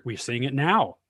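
ordinary delivery

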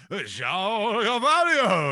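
very high, loud and drawn out slowly like a PA call; stadium announcer voice. Retold or imitated. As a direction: stretch the name out over the tannoy. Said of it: "that accent".

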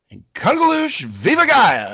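bright high exclaimed greeting; excited greeting. Acted. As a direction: call it out like a show opener. Show opening catchphrase greeting.

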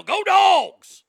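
loud, high-pitched crowd holler; mock fan yell. Fan heckling a live reporter in passing, yelled. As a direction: belt it like a rowdy fan. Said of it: "gonna come up and yell".

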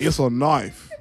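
lightly voiced Aussie wildlife host; a hint of a khaki-clad croc wrangler; Crocodile Hunter voice. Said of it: "I said it in my, my Crocodile Hunter voice".

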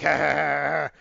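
loud imitated agony cry; hammed-up wail as if electrocuted; mock pained scream.

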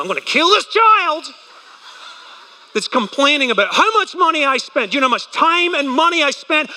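put-on high, frazzled parent losing it at the kids; cracking demon parent. Parent at Disneyland snapping at complaining kids, high frantic rant. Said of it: "this cracking demon comes out of your mouth".

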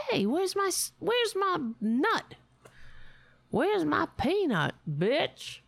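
high demanding squirrel voice. Retold or imitated. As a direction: demand your peanut like a cheeky squirrel. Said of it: "That's what he sounded like".

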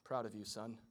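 low, soft deadpan aside; understated sarcastic dad; dry sarcasm. Pretend. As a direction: mutter it with dry irony.

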